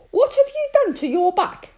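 put-on high, loud child's voice; little girl voice. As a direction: ask like a worried small girl.

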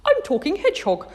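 put-on thrilled child, pitched right up; excited discovery. A narrator's reading. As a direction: marvel at your own new trick. Girl thrilled at her new skill.

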